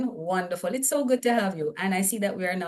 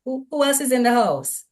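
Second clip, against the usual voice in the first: excited cheering; 6 semitones higher, 3 dB louder than usual. Real, not acted. Host calling for more viewers to check in, excited cheering. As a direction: hype up the roll call.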